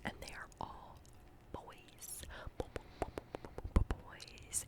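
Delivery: ordinary talk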